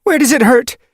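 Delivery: high, loud sharp question. A narrator's reading. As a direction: snap the question urgently. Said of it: "she asked sharply".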